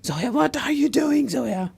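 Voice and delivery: a gentle mock shout at a driver; lightly acted road rage; mild mock scolding. Shown as a demonstration. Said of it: "pretend you're shouting at me".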